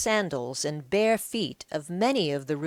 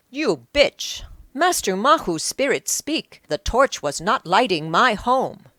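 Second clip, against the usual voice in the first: loud, high, accusing yell; furious shout; 5 semitones higher, 6 dB louder than usual. Acted. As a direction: hurl it at her in rage. Udium discovers the deception.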